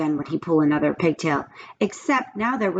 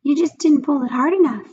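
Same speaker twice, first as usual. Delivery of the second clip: high, loud tempting little voice; pigtail voice; 8 semitones higher, 4 dB louder than usual. A narrator's reading. The pigtail coaxes Paul in a high little voice. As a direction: coax him like a tiny tempter. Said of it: "said the pigtail".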